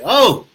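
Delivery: delighted exclamation. Real, not acted. Tasting his happy hour drink.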